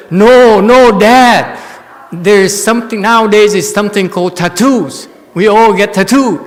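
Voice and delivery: put-on children telling Dad about tattoos, loud and high; children answering. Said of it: "The children says".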